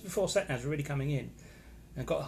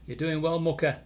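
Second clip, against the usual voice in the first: loud, raised-pitch northern English greeting; northern English accent; 4 semitones higher, 8 dB louder than usual. Acted. Reading a viewer's name out, in a northern English accent. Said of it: "doing a northern accent".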